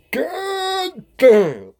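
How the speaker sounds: high, loud, stretched-out mock deaf speech; deaf friend's voice. Telling about a deaf friend spotting police, high mock deaf voice. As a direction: drag out god damn like his deaf buddy. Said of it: "So it was like".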